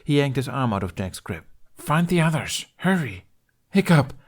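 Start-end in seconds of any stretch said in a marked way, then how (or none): 1.8-3.2 urgent. Acted. Urgent order to flee. 3.7-4.1 loud high call after someone. Acted. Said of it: "Jack called after him".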